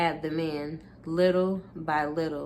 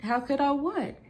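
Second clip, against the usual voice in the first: much higher, outraged on I; indignant throw-back; 8 semitones higher than usual. Acted. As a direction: throw his question back, incredulous. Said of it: "You asked".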